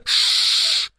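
sharp whispered shh; shushing hiss. A narrator's reading. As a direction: hush them at once. Shushing the younger children.

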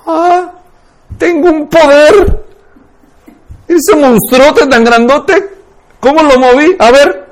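put-on high, loud voice of a child discovering power; amazed child. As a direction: play a little kid marveling at their own power. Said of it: "El niño descubre algo, dice".

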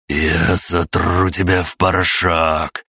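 slow, menacing hoarse threat; hoarse rasp. Acted. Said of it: "прохрипел".

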